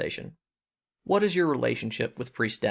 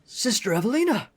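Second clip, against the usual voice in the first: put-on gruff old dwarf lighting up, higher; delighted greeting; 9 semitones higher than usual. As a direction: call out her name with joy. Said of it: "he's like".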